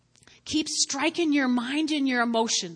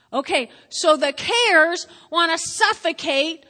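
loud, higher preaching voice; preaching at full force; 4 semitones higher, 7 dB louder than usual. Acted. Pastor warns the church about worry, preaching loud and high. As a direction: drive the warning home to the church.

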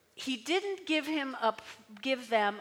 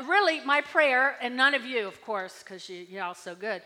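ordinary talk

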